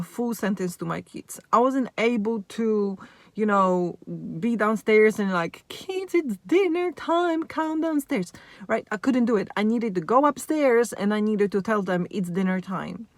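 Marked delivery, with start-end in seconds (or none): 5.7-8.3 calling out. Acted. Mum calling the kids downstairs for dinner, high restrained call-out. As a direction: sing out higher, as if calling upstairs. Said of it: "no way I could raise my voice".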